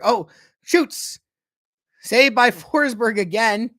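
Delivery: high, loud play-by-play burst; excited save call. Real, not acted. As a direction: exclaim the repeat save with real excitement. Second shot and save live.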